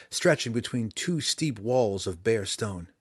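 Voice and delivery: ordinary delivery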